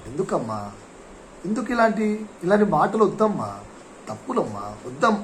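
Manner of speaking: put-on soft kind scolding to a child; gentle calm reply. Shown as a demonstration. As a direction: talk someone down softly and kindly. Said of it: "మామూలుగా సానుకూలంగా".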